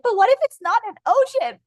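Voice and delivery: high, put-on fretting voice; mock worrier. Voicing hesitant listener.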